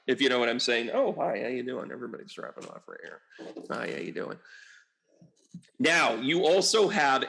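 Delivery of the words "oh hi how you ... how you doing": quiet aside. Real, not acted. Aside to chat viewers.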